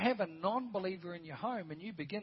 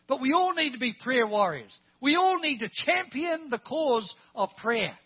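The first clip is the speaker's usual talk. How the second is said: loud, high pulpit rallying cry; rally the whole congregation, punching it out; rousing preaching; 7 semitones higher, 12 dB louder than usual. Real, not acted. Preacher calling everyone to be prayer warriors, rousing preaching.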